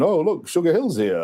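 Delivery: imitated sarcastic announcer. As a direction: put on sarcastic wonder, swinging the pitch up high. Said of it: "sarcastically saying".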